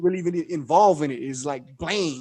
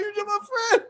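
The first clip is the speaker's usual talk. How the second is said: high-pitched mock whimper, softer; imitated crying; 13 semitones higher, 5 dB quieter, faster than usual. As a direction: imitate a tearful friend while amused. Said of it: "Blaine started crying".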